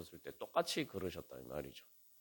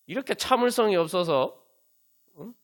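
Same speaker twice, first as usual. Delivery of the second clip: loud, raised-pitch parental reproach; mock-scold an impatient baby; acted scolding; 8 semitones higher, 6 dB louder than usual. Parent to a crying baby, acted scolding. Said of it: "책망합니까?".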